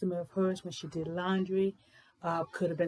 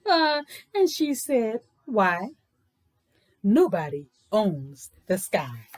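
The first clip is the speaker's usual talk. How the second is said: louder, measured storybook reading; read-aloud story narration; 4 dB louder than usual. A narrator's reading. Teacher reading a picture book aloud to young kids, louder storytelling voice.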